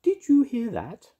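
very high, loud fairy question; piping high fairy voice. A narrator's reading. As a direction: squeak it out like an alert fairy. Fairy figure asks excitedly.

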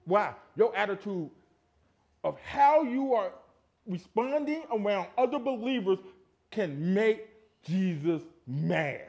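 slow, loud pulpit emphasis; emphatic preaching. Acted. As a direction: stretch out each phrase to convict the congregation. Preaches at full force.

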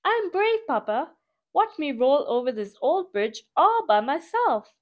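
put-on high eager young engine; a proud little train showing off to Papa. Young train boasting to his papa, high and eager. Child train Big Chew speaks.

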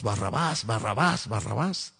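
ordinary talk

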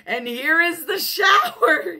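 high, loud gleeful announcement; big, high storytelling yell; excited shout. A narrator's reading. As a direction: shout it out with a flourish. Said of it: "he shouted".

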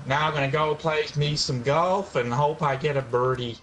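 hammed-up American accent with a laugh; amateur American accent, amused. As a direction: put on a jokey American accent. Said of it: "That's his American accent impression".

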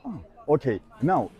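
normal talking voice

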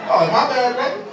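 high, flustered apology; hammed-up nerves; nervous. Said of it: "I got so nervous".

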